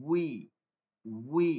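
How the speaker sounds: উই begun with rounded lips, a clear w. Shown as a demonstration. Teacher demos waw with kasra, lips rounded. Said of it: "ঠোঁট গোল করে বলবো".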